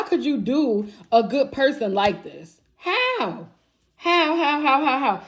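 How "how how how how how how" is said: higher, pleading how over and over; incredulous repeated how, pitched up; exasperated disbelief. Real, not acted. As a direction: hammer the how in outrage. Outraged at betrayal of reader.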